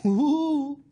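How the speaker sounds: a quick high "Woo" whoop; imitating a chirpy crowd-pleasing woo; mock little woo laugh. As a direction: toss in a silly little whoop. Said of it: "a little laugh in the middle, like".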